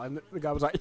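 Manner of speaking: ordinary talk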